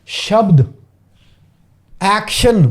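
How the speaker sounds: ordinary talk, nothing marked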